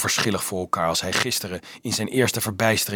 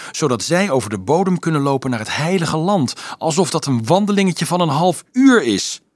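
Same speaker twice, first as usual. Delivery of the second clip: put-on louder, higher, getting worked up at the children's folly; a boy venting his disbelief; indignant outburst; 5 semitones higher, 6 dB louder than usual. A narrator's reading. Dolf rails to Marieke about the crusade, indignant and loud.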